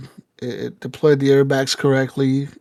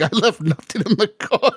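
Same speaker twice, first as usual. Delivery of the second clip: higher-pitched quote dissolving into laughter; laughing retelling; 7 semitones higher than usual. Retold or imitated. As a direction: crack up while imitating your girlfriend. Laughing while quoting girlfriend.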